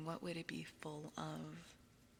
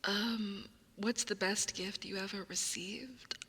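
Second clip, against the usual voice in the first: whispering; 2 semitones higher, 6 dB louder than usual. Acted. ASMR whisper.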